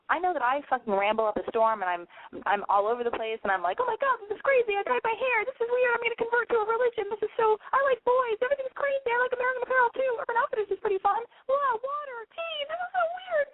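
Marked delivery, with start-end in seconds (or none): 3.8-13.5 very high, giddy airhead gush; mocking ditzy valley girl. Host mocking her own rambling as a high valley girl. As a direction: ham up a scatterbrained valley girl. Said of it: "That's how I talk".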